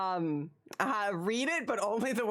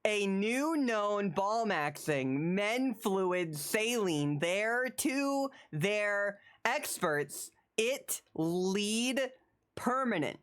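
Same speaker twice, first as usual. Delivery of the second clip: slow halting read; much slower than usual. Acted. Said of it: "You read every other word".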